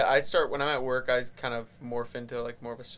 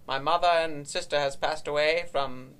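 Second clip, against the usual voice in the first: put-on Transatlantic accent; 3 dB louder than usual. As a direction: put on a mock early-1900s Transatlantic accent.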